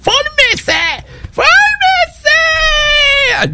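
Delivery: chillido agudísimo y alargado de mando; grito imitado de funcionaria. Retold or imitated. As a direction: imita a una empleada gritona del consulado, muy agudo. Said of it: "Y hasta te gritan". (grita la orden con voz chillona, estirando el final)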